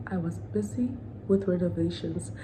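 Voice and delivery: breathy whispered retelling of his excuse; voiceless mocking whisper. Repeats ex's excuse, hushed.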